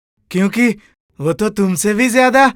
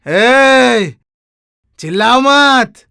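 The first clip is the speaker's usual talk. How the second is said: raised-voice, drawn-out warning; angry command; 3 dB louder, much slower than usual. Acted. Brother tells brother to stop shouting, angry. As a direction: silence him with a louder, stern order.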